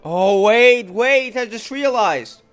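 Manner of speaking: loud, high burst of sudden realization; excited realization. Real, not acted. Player interrupts the game with a sudden thought, excited. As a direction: blurt it out high and loud, drawing it out.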